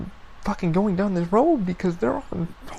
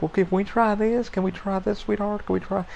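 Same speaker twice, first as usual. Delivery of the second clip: higher-pitched, coaxing lady voice; mimicked phone agent, pleading sweetly in a higher voice; call center lady; 5 semitones higher than usual. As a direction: imitate a sweet-talking service rep, pitched up. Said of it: "that's how she talked".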